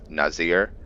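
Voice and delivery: usual speaking voice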